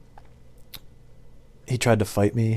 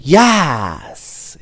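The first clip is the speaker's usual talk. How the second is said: loud, drawn-out caller's greeting; odd caller; 4 semitones higher, 5 dB louder than usual. Retold or imitated. Regular caller skipping hello, imitated loud and drawn-out. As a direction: answer like that strange customer on the line. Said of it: "He wouldn't say hello. He'd just start with".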